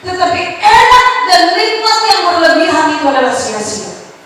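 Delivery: loud, high proclamation; preaching at full force. Acted. Preacher warning the congregation, loud and high. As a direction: hammer the warning home. Preacher drives home point.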